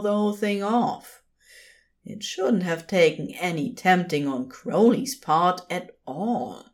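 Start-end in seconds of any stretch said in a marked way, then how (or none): none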